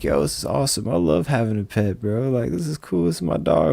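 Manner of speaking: lightly acted joy over a new dog; retold delight. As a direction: replay how thrilled he felt. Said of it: "I'm like".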